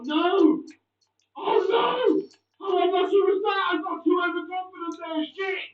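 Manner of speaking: loud, high, furious outburst; frustrated yelling. Real, not acted. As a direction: yell at the missed chance. Missed chance in game.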